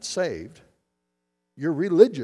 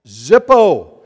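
emphatic answer; 14 dB louder than usual. Real, not acted.

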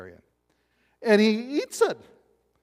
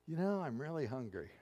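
understated aside; 2 semitones lower, 13 dB quieter than usual. Retold or imitated. Jesus' understated remark, acted.

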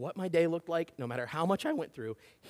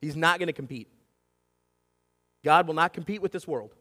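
usual speaking voice

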